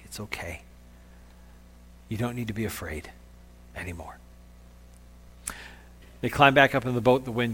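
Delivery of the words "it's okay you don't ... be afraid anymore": hushed, low comforting; tender. Acted. Jesus comforting a shamed Peter, hushed and tender. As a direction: reassure very gently, almost under the breath. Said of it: "That tender moment between Jesus and Peter".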